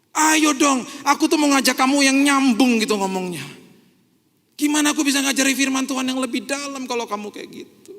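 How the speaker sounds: loud, high pleading; exasperated Paul. Pretend. Paul voiced by the preacher, loud and exasperated with the Corinthians. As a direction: urge them to finally understand. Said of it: "Itu Paulus ngomong gitu loh".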